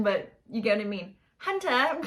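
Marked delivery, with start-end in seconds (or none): 1.4-2.0 a higher, lightly put-on child's accent; bad Omega impression. Fan imitates Omega calling Hunter. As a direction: say the name in a mock kid voice. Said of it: "Also a bad impersonation".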